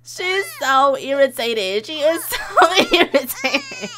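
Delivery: loud, rising, breaking into laughter; amused laughing. Real, not acted. Amused by the irritated baby.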